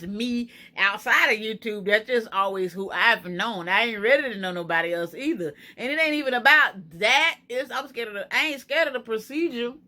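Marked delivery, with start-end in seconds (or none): none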